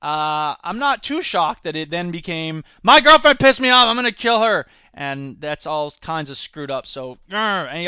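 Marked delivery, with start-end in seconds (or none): 2.8-4.7 imitating an angry man, a burst louder; mock angry boyfriend. Quoting the killer's rage, mock angry burst. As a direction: shout it as the jilted boyfriend.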